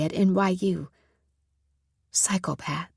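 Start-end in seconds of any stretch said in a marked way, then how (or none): 2.1-2.9 breathy whispered word. Acted. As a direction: hush it like a secret fear. Private thought, dark realization.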